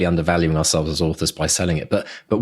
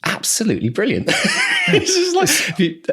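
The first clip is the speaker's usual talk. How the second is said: high, laughing praise; real delighted laugh. Real, not acted. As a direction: gush with a laugh. Delighted recalling cat cafe.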